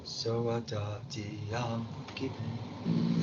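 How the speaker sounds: soft, very slow voice on "I'm forgiven"; soft mock aside. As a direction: drag "I'm forgiven" out quietly. Teacher mimics a student. (susurro lento, imitando a un alumno)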